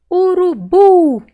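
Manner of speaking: "Urubu" slow and loud, high long BU; stretched stressed syllable. Shown as a demonstration. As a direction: call out "Urubu", holding BU. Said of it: "Qual que eu demorei mais falar".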